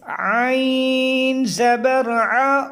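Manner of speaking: आइन ज़बर आ with the आइन pressed from the throat, slow. Shown as a demonstration. Teacher showing how आइन comes from the throat. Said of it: "हलक से आवाज़ निकालनी है".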